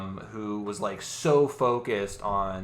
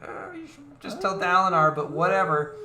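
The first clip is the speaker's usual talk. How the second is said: pitched up, offhand character voice; high dismissive Syl voice; 8 semitones higher than usual. Retold or imitated. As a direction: shrug it off as Syl. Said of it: "Syl's like".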